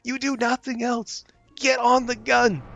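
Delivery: high, strained begging at the crew; desperate pleading. Real, not acted. Gamer begging idle crew to man the gun, desperate. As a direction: beg them to just get on the gun.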